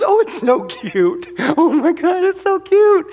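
cooing, amused condescension; high, laughing baby talk; mocking cuteness. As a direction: talk down like at a toddler. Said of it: "he looks down and he goes".

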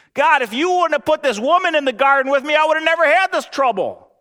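Adam whining to God, high and loud; whiny Adam. Acted. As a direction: plead your innocence like a guilty child.

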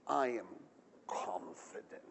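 very low, hushed assurance; quiet confidence. Acted. Preacher voicing David amid chaos, hushed confidence. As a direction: drop right down, pausing before the last word. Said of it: "do confident".

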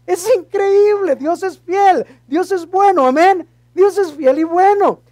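loud, high preacher's exclamation; real exultant preaching cry; fervent praise. Real, not acted.

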